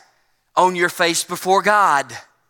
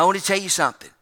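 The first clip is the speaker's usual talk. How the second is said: ordinary delivery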